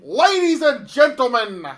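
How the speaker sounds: Paul Heyman. Acted. Advocate introducing his champion, loud proclamation. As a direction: proclaim it grandly to an arena. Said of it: "my name is Paul Heyman".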